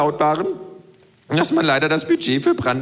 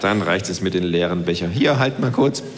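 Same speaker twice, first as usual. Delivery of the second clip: dry low self-voice; lower narrator voice; 7 semitones lower than usual. Acted. Narrator answering the kangaroo, lower and dry.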